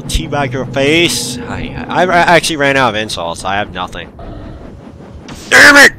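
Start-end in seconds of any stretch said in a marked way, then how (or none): 5.5-5.9 sudden high, loud curse; frustrated shout. Real, not acted. Gamer dying again in a hated level, a sudden furious shout. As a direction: blurt it out as you die.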